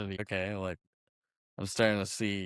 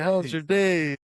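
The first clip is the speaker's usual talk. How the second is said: higher, hammed-up TV dad voice; mock sitcom dad; 8 semitones higher than usual. Bender mocking a perfect family as a cheery sitcom dad. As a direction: play a cheery fifties TV father. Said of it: "he reenacts this Leave It to Beaver scene".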